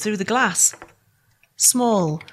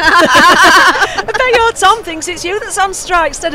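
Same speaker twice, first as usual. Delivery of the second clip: laughing tease; 7 semitones higher, 6 dB louder, slower than usual. Acted. Teasing friend on picket.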